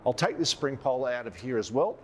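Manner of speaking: ordinary delivery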